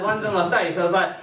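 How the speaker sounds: ordinary talk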